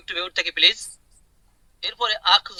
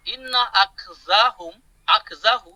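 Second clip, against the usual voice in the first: slow recitation; much slower than usual. Shown as a demonstration. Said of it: "teacher recites verse slowly".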